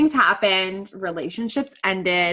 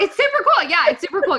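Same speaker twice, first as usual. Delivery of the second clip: gushing excitement; 9 semitones higher, 6 dB louder, faster than usual. Real, not acted. Enthusing about program.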